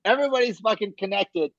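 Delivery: high-pitched, gleeful I-told-you-so; excited vindication. Real, not acted. Co-host hearing the cousin knew Ed, excited vindication. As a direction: crow with high, laughing excitement. Delighted at learning of a connection.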